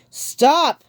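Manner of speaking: loud, high commanding cry; booming command; cried out. A narrator's reading. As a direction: boom it over the crowd. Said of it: "Logan cried".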